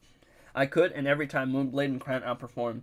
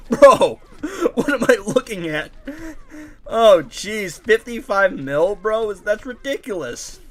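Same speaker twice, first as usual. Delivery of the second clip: excited disbelief; 7 semitones higher, 8 dB louder, slower than usual. Real, not acted. Shocked at huge damage number.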